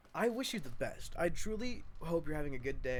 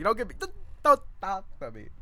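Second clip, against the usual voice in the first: louder, faster protest; mock defensive; 7 semitones higher, 9 dB louder, faster than usual. Real, not acted. Teasing viewers about being a nerd, louder mock protest. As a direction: play up being offended.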